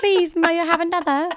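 high, prim begging bear; polite bear voice. Pretend. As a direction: ask sweetly like a hungry bear.

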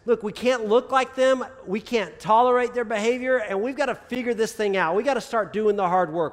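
loud, high, driving sermon climax; fiery preaching. Acted. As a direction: push the church to act, full force. Preaching crescendo.

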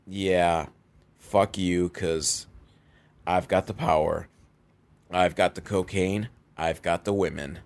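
low and quiet, totally in charge; a villain too powerful to raise his voice; unbothered eighties villain. Retold or imitated. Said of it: "they don't have to raise their voice".

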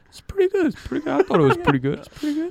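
lightly voiced smug friend fishing for praise, pitch jumping up; a hint of a proud improv kid waiting for applause; smug self-praise. Mimicking a smug friend who wants a compliment. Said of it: "Will looks at me and go".

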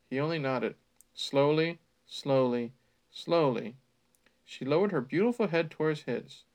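usual speaking voice

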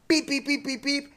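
phone dialing beeps. Retold or imitated. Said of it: "and goes".

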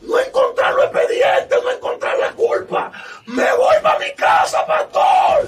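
very high, loud wail of overjoyed relief; acted weeping inmate shouting to his pastor; imitated tearful cry. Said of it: "el hombre viene llorando".

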